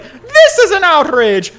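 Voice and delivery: high, loud, slow mock indignation, laughing through it; hammed-up shocked outrage; outraged wife. Wife discovering the mistress's bookmark, hammed-up outraged shriek. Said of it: "She's like".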